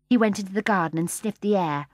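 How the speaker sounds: usual speaking voice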